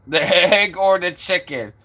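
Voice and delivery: laughing through the riddle; giggling into a burst of laughter. Real, not acted. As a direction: crack up at your own question. Laughing at own silly riddle.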